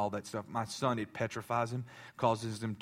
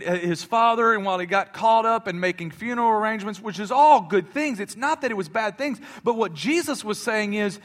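louder, higher, building to full force; building preach; 9 semitones higher, 9 dB louder than usual. Acted. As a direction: build up the urgency toward the cross.

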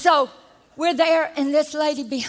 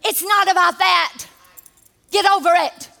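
raised voice, telling her off; firm, loud correction of a rude mom; mock scolding shout; retold shout; 3 semitones higher, 4 dB louder than usual. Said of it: "what I was wanting to say".

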